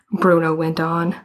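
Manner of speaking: ordinary delivery, nothing marked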